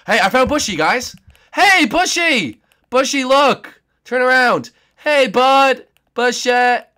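loud high excited hollering; excited calling. Real, not acted. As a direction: shout with glee across the map. Excited calling to found friend.

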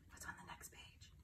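soft unvoiced whisper. Real, not acted. Parent whispering to child at page turn, hushed. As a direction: hush it like a secret. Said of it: "whispered page turn to child".

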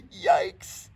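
high, loud startled yelp. Acted. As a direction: yelp as the bottle gets smashed. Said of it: "yelped Doggy".

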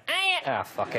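very high mock machine noise; imitated answer machine. Comedian acting out the answer machine picking up, high mimicry. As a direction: shoot right up high for the machine. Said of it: "as soon as my answer machine picked up".

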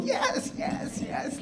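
tiny high-pitched squeal of yeses; hushed mock squealing; imitated girls cheering. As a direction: mimic excited girls, high and very soft. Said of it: "the girls are all going".

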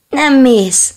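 high, loud yelled cry; little dog yelling at its reflection; shout. Acted. Little dog shouts at the dog in the water. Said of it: "kiáltott a kiskutya".

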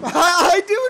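high, loud outburst through laughter; manic laughing admission. Pretend. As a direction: blurt it out with a wild laugh. Admits seeing demons, laughing.

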